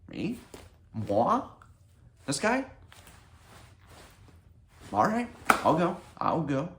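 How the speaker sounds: high, squeaky "who, me?" feigned disbelief; mock surprise. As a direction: play coy, pretend shocked and flattered. Mock modest reaction.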